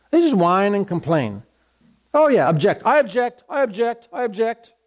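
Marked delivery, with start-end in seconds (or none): 2.1-4.7 petulant I object with rising ends; lightly hammed-up protest; mock objecting. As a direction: whine the objection higher. Mocking objectors.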